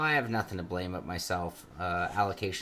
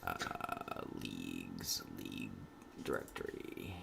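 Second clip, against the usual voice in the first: whispered muttering; 16 dB quieter, slower than usual. Real, not acted. Muttering to self while searching.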